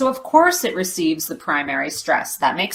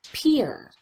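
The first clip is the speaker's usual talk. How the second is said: peer high and falling; primary stress; 6 semitones higher, 3 dB quieter than usual. Shown as a demonstration. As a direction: stress peer with a pitch drop. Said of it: "as we do on this last syllable".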